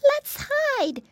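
very high piglet exclamation; exclaiming. Acted. Flop fears the wolf, high-pitched exclamation. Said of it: "exclaimed Flop".

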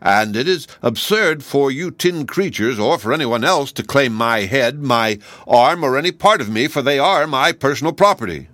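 surly, emphatic claim; growling. A narrator's reading. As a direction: insist crossly on what is yours. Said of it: "in a voice like a growl".

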